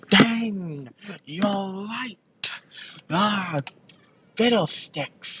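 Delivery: high-pitched hammed-up dismay; mock frustration. Old hag admits the girl is right, mock frustrated. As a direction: curse mildly in a high cartoonish voice. Hag loses her trick, voiced.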